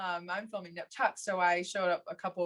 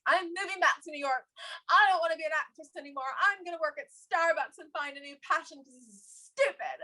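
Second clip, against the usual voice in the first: high, fast, overwrought rant; dramatic despair; 8 semitones higher, faster than usual. Retold or imitated. Telling her friend she quits acting, high and breathless. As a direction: throw it all away in a rush. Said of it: "So I called her and I was like".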